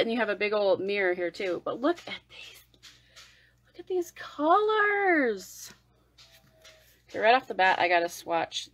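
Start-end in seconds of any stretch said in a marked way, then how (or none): 1.7-5.7 high, slow, hushed awe; awed gushing. Real, not acted. Showing viewers the palette, high awed gushing. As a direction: drink in the colors.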